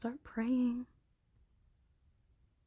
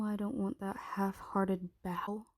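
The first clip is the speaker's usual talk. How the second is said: normal talking voice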